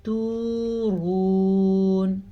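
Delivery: تورون with both waws stretched long; prolonged vowels; long madd. Shown as a demonstration. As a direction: draw out each و in تورون very slowly. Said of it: "دو واو مدہ ہیں ... کھینچ کے پڑھنا ہے".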